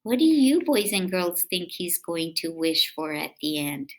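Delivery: ordinary delivery, nothing marked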